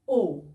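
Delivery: او drawn out as a long o. Shown as a demonstration. Teacher showing students the long o sound. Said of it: "واو ممدوده او مضمومه او لونج او".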